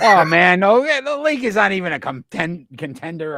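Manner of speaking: high, loud protest through laughter; amused dismissal. Real, not acted. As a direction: laugh off the very idea. Joking dismissal of the Lakers.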